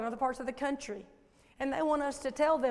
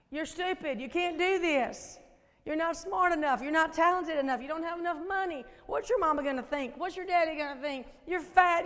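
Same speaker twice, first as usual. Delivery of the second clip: accusing enemy voice; 3 semitones higher, 3 dB louder than usual. Acted. Preacher voicing the enemy's lies to her listeners, nagging accusation. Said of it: "Do you ever hear that voice?".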